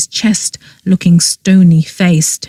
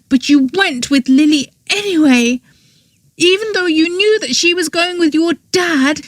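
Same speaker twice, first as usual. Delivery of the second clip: higher, scornful tirade; higher girl voice raging with scorn; angry outburst; 11 semitones higher, 3 dB quieter than usual. A narrator's reading. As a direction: lash out at him, pitched up. Girl's angry confession to Oberon.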